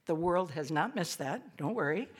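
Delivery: ordinary delivery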